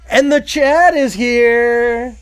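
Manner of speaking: high, loud, slow announcement; announcer voice. Pretend. Host announcing his friend arriving, mock announcer voice. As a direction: introduce him like a star walking in.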